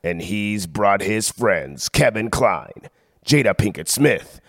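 smooth, measured movie trailer read; announcer voice selling a comedy sequel; trailer voiceover. Acted. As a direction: sell it like a big studio trailer.

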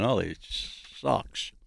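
soft, drawn-out bummed-out grumble. Retold or imitated. Host recalling sitting bummed out after the break, soft deflated grumble. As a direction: stretch it slowly and quietly, a bit deflated. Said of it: "last break bummed out".

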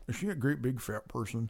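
low, soft creepy killer voice; a lightly voiced creepy killer; Jame Gumb impression. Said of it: "your James Gum saying".

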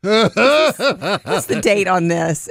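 slow, suspicious fretting voice; mock worried. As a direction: fret over the milk slowly. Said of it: "you're like".

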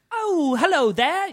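high, slow "Oh, hello there" in a put-on British accent; imitating an American faking British; mock posh British. Comedian mimics Americans greeting a Brit in British accent. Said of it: "normal people will just be like".

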